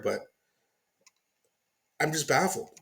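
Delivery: ordinary talk, nothing marked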